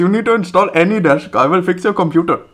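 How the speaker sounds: high-pitched Indian scam caller; hammed-up, insistent Indian-accented patter. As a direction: push the fake tech fix in a high Indian accent. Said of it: "I'm from India".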